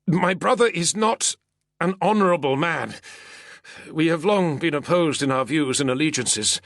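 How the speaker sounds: nervous senator. Acted. Senator voiced, still frightened.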